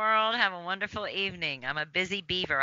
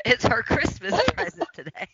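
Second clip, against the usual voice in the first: laughing; 6 semitones higher than usual. Real, not acted. Daughter laughing while talking.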